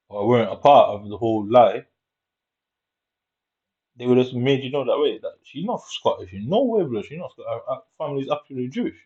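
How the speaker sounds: ordinary delivery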